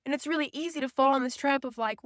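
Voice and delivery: usual speaking voice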